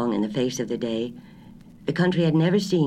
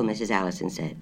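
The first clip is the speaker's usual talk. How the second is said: plain reading voice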